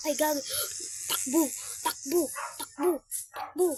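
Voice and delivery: loud frantic yell to run; yelling while running. Real, not acted. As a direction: shout it in a panic. Fleeing a mob, yelling run.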